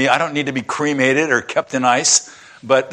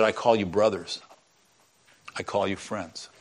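softer and lower, gently assuring; tender reassurance; 7 semitones lower, 9 dB quieter than usual. A narrator's reading. Jesus assuring his followers, soft and tender. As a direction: hush it down like a comfort.

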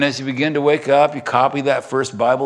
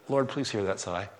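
quiet prayer; 6 semitones lower, 12 dB quieter than usual. Retold or imitated. Retells a murmured morning prayer.

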